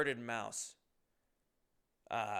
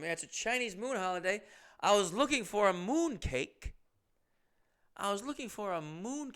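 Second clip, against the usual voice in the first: mild mock Chinese accent, pitched up; higher, toned-down Chinese accent imitation; 6 semitones higher than usual. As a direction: imitate her Chinese accent without going all the way. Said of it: "I'm not doing it to the nth degree".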